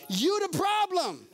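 You the problem, slow and pointed; emphatic. Acted. Preacher pointing at the listener, slow and emphatic. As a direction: land the blame squarely.

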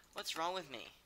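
normal talking voice

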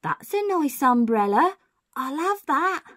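high, slow female-burglar voice; lady thief admiring her haul; mystery burglar. Acted. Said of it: "a voice says".

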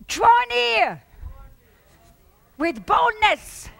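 loud and high, boldness drawn out slow; bold command. Acted. Preacher voicing Jesus calling them near, loudly. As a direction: proclaim it as a shout. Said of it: "And Jesus says".

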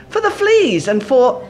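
calmly grateful sister; serene Betsy. A narrator's reading. Betsy thanks God for the fleas, serene. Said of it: "Betsy went on serenely".

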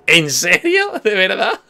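exasperated disbelief. Real, not acted. Disbelief after in-game death.

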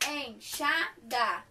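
enxada said slowly, syllable by syllable; syllable-clapping. Shown as a demonstration. Teacher clapping syllables with the class, slow and spaced. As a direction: clap out enxada slowly in three beats. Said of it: "bate palminha".

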